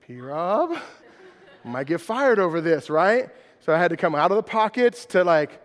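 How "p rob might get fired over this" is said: laughing aside. Retold or imitated. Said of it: "I'm like".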